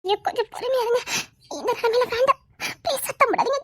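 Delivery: soft, urgent hush to keep it quiet; hushed plea. Acted. Wife hushing her husband so uncle outside won't hear, softly pleading. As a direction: beg him quietly, nearly crying.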